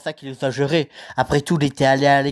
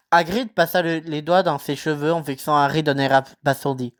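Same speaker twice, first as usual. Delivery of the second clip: ordinary delivery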